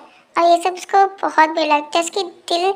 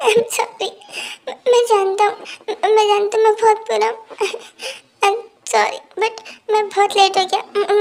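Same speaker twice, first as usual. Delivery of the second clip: higher-pitched sobbing, words caught on sniffles; tearful apology; 3 semitones higher than usual. Acted. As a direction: cry through a halting, stammered apology. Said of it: "बहुत रोता है".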